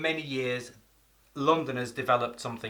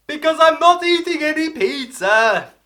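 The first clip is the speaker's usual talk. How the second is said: mock exaggerated emphasis; 14 dB louder than usual. Joking punchline about pizza.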